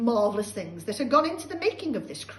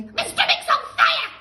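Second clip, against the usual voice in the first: panicked shout; 12 dB louder than usual. Acted. Grandma burning inside, screeching shout. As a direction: shriek for help.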